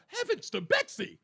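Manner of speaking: mock shocked Democrat; mock prim gasp. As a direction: play a scandalized church lady. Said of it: "hear that language and go".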